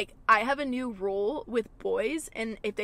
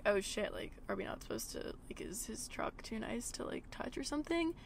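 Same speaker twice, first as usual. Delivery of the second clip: much softer, unsure whispered worry; confused whisper; 10 dB quieter than usual. Retold or imitated. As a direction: whisper it like you're in trouble. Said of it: "And I was like".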